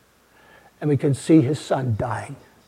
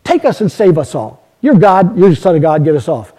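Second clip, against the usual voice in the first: loud, jeering thief on the cross, with a scoffing laugh; mocking criminal; 8 dB louder than usual. The mocking criminal jeers at Jesus from his cross, loud and scornful. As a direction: taunt him, loud and scornful. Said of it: "The other guy looked at him... and said".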